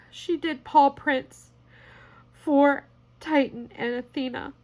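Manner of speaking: high, tearful gratitude; choked up, words coming haltingly; holding back tears. Real, not acted. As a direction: thank them while fighting real tears. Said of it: "I'm trying not to cry on my channel".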